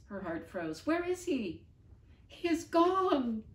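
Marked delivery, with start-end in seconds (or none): 0.9-1.6 panicked question. Acted. Avril searching in panic. 2.3-3.4 Bonaparte's slow, high, loud howl; howling grief. Acted. Bonaparte howling to the others that the dog is gone, slow and high. Bonaparte's howls fill the air.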